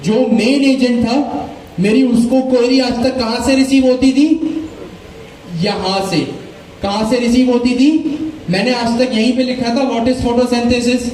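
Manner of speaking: usual speaking voice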